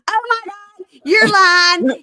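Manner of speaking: high, loud mock gasp of disbelief; hammed-up outrage at catching a lie; mock shocked accusation. Girlfriend catching him lying on the phone, high mock outrage.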